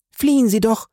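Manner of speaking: high-pitched, insistent call; urgent cry. A narrator's reading. Pitying young lady urges the trapped fugitive again, urgent cry. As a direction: urge him desperately to run. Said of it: "rief Fräulein d'Armilly".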